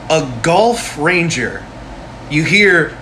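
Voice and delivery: ordinary talk, nothing marked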